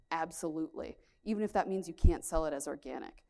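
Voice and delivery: ordinary delivery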